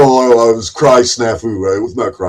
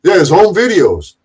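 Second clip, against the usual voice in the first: usual speaking voice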